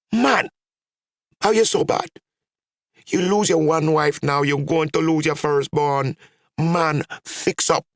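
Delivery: very high-pitched aunt's scolding; shouted scolding. A narrator's reading. Aunt scolds her brother over losing his firstborn, shouting. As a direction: cry out high, telling him off. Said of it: "come out and shouted".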